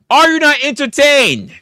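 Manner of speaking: hammed-up arena challenge to the crowd; gladiator shout. Streamer bellowing at his chat for donations, mock gladiator shout.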